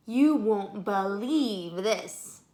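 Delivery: slow, faraway wonder; distant voice. Acted. Annie calls from the cavern, slow faraway amazement. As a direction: call it from the far end of the tunnel. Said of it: "Her voice sounded far away".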